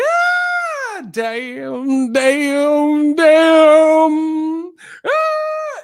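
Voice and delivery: wailing, very high falsetto screech; Mama Payne. Retold or imitated. Retelling Mama Payne's reaction to the dead bird, high mock wail. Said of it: "Mama Payne's like".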